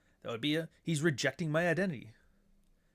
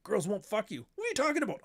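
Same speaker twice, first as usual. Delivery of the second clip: 1 mocking conservative; 8 semitones higher than usual. Mocking hurt conservative critics.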